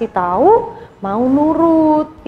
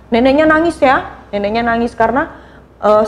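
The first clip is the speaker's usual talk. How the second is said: normal talking voice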